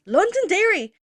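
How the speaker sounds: loud, high outraged exclamation; pissed-off disbelief. Retold or imitated. Seeing the name on the board, outraged. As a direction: blurt it out, scandalised. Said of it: "Now I'm pissed".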